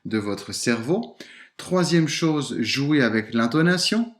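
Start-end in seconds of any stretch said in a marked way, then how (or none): none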